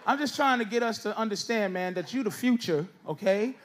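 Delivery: ordinary talk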